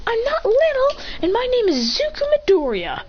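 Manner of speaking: put-on high-pitched childish protest; indignant little kid. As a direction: pipe up like an offended child. Kid protests being called little.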